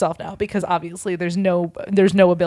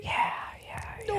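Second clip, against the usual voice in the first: a hushed, breathless whisper; 15 dB quieter than usual. Real, not acted. Storyteller whispering yes after the friend asks if he killed Harry. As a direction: whisper it, stunned.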